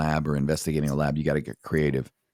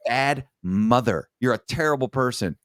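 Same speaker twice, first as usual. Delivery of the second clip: higher, emphatic telling-off; retold scolding; 5 semitones higher than usual. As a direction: retell a blunt condemnation, punching the words. Berates the mother in retold dialogue.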